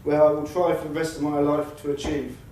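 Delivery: ordinary talk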